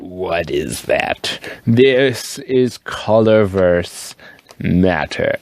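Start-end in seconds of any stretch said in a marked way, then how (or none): 0.0-1.4 imitating a gobsmacked viewer; mock amazed viewer. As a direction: play a stunned viewer. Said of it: "you might be like".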